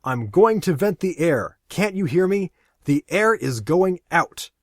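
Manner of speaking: loud, high and furious; angry shout. Acted. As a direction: yell at her to hear him. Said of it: "Angrily, he pushes her".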